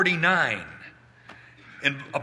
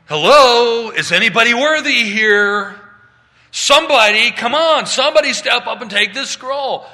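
loud, high, hammed-up frantic call; mock stressed-out shouting; 9 semitones higher, 13 dB louder than usual. God as he is not, stressed out, mock frantic shouting. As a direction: holler for a volunteer, pretending to panic. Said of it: "God doesn't say".

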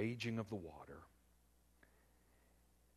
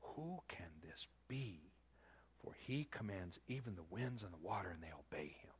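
awed whisper; 3 semitones lower, 7 dB quieter than usual. Retold or imitated. Frightened disciples whisper to one another in awe. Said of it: "they said".

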